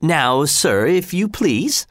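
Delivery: high, courteous request, slowing at the end; polite. A narrator's reading. Executioner politely addressing his victim Arnold, high and courteous. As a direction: invite him with gentle formality. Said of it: "said the executioner politely".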